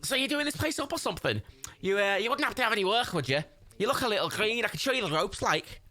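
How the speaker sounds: high, thick Scouse chancer; high-pitched cartoon Scouser; Scouse Jimmy. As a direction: argue in a squeaky Liverpool accent. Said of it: "Scouse Jimmy".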